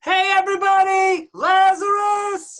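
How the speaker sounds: high, loud shout to the whole crowd; hollering with the name drawn out slow; calling out. Acted. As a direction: shout it to everyone around. Announcing Lazarus to the crowd.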